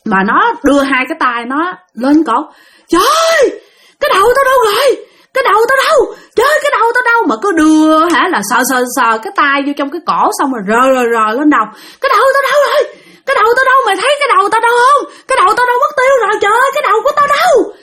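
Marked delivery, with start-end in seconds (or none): 2.9-7.2 very high-pitched acted wail; panicked wailing. Possessed friend searching for his head, high frantic wail. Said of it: "ảnh la làng lên". 12.0-17.7 very high, rushed frantic wail; wailing and crying out. Retold or imitated. Possessed friend groping for his head, high fast wailing. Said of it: "vừa la vừa khóc mà vừa gào".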